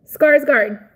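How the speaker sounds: Skarsgård with a hard rolled ending, loud and emphatic. Shown as a demonstration. Showing viewers how he says Skarsgård, loud and emphatic. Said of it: "I heard him say Skarsgård".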